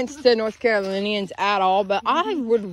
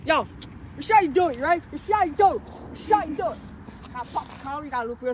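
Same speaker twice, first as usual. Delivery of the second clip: show-off demo; 4 semitones higher, 5 dB quieter than usual. Shown as a demonstration. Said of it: "Y'all doing it so wrong".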